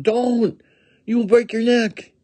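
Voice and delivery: high, alarmed warning cry; alarmed calling out. A narrator's reading. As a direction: warn them as if shuddering. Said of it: "people shudder and call out".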